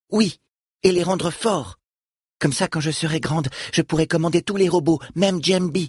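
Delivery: a lightly voiced young girl. Falom speaks at length.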